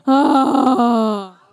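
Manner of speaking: a long, fed-up sigh; sighing "Ah" for the crowd; exasperated sigh. Shown as a demonstration. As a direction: show the crowd how to sigh in despair. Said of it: "make a sigh of exasperation".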